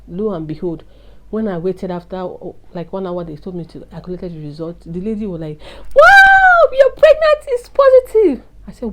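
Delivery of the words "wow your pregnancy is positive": very high, loud squeal of excitement; mock excited shout. Lady at the hospital announcing a positive pregnancy test, excited shout. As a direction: burst out shrieking the good news, hammed up.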